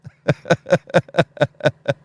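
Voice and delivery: real high burst of laughter; cracking up at the chat. Real, not acted.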